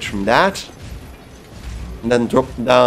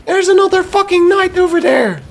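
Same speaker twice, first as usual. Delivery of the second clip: very high, strained outburst; voice-cracking yell; 3 dB louder than usual. Real, not acted. Player spotting another knight, voice-cracking alarmed yell. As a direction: yell it way up high till the voice gives out. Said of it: "I'm losing my voice".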